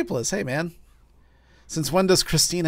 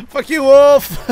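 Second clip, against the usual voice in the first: loud, high taunting yell; defiant shout; 8 semitones higher, 11 dB louder than usual. Real, not acted. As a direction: yell the curse at your pursuer. Cursing the game wolf mid-chase.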